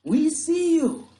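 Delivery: long, high, loud holler; drawn-out shout from a boat; distant sailor's shout. Acted. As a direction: holler slowly across the water. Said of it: "There was a shout from across the water".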